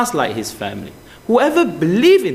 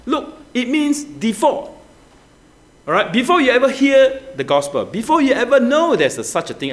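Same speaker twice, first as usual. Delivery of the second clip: ordinary talk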